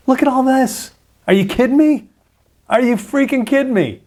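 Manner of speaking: can't believe the size of it; amazed disbelief. Real, not acted. To viewers, stunned by the supercluster map, in amazed disbelief.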